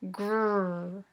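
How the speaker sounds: "Grr" held as one rolling growl-like sound; gr blend. Shown as a demonstration. Teacher models the gr blend for the class. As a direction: buzz the gr blend together. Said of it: "Say it with me".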